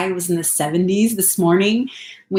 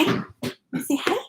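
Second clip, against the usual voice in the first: higher, softer cooing question; 7 semitones higher, 7 dB quieter, faster than usual. Real, not acted. Mom softly asking her son to say hi, cooing baby talk. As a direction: coo it softly with a rise.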